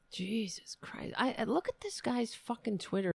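breathy disbelieving whisper under the breath; exasperated whisper. Real, not acted. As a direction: whisper it in disgust. Muttered reaction to clip.

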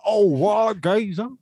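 high, slow Cockney geezer impression; drawn-out hammed-up Cockney; loud comic Cockney exclamation; mock Cockney. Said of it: "write in such a way that it was like".

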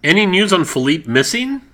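loud, shouted banter. Acted. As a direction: belt it out like live TV. Segment shouted loudly.

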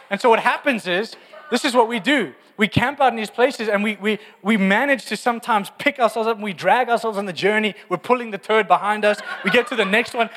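loud, high, driving sermon delivery; fired-up preacher pushing the point; full-force preaching. Acted. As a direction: preach it out to the whole church.

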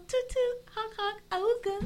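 high silly toot-toot honking voice; silly clown. Pretend. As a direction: honk it out like a cartoon clown. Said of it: "what the hell is that? That's like Moana".

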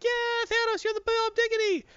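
very high squeaky lackey voice; flattering minion. Retold or imitated. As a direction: squeal the praise up high like a cartoon toady.

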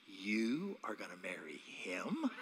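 slow, low whispered disbelief; incredulous whisper. Retold or imitated. Mother asking fiancée in disbelief, whispered. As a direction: hush it out in shock. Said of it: "and she goes".

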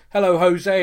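"Hello, Jose" called loudly; calling out. Retold or imitated. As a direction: call out the greeting across the room. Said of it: "in the clip it goes".

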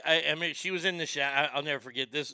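ordinary talk

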